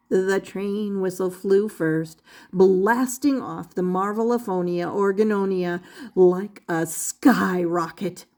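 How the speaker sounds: ordinary delivery, nothing marked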